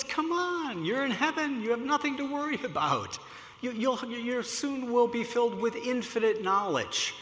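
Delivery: high-pitched, gentle cajoling; Albert Einstein coaxing. Acted. Einstein coaxing a reluctant man in heaven, high-pitched reassurance. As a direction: coax a shy newcomer, voice held high.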